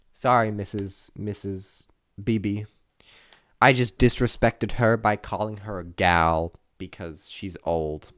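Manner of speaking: usual speaking voice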